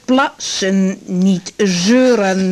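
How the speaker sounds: slow, firm commands, every word drawn out; strict. Acted. Doctor sternly ordering her patient to pee. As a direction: lay down the law like a strict teacher. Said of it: "Wat ben je zalig streng".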